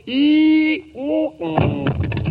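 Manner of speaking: high swooning whimpers fading out; fainting whimper. Acted. Boy faints as the girls swoon over him, with high fading whimpers. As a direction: swoon away with little squeaks. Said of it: "Mister [name] has fainted".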